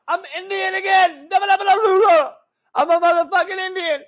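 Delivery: lightly voiced giddy Kamala, high; happy Kamala. Comic imitating Kamala, a hint of high giddy glee. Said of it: "She's happy as fuck".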